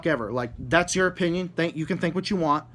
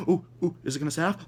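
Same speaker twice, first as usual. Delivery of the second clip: mock nervous prisoner; much faster than usual. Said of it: "set off and be like".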